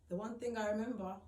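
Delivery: ordinary delivery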